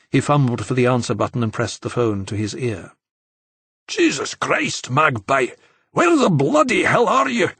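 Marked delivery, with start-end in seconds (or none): 3.9-7.5 put-on Scottish accent, exasperated and higher; Scottish brogue. A narrator's reading. Scottish friend on voicemail demanding where Enzo is, in a Scottish accent. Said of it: "Scottish brogue was always particularly strong".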